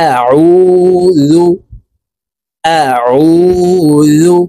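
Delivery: আউযু with the ain pressed from mid-throat; correct ain sound. Shown as a demonstration. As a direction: squeeze আউযু from the middle of the throat. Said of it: "এভাবে পড়ব। দেখুন". (হলকের মধ্যখান থেকে চাপ দিয়ে আউযু)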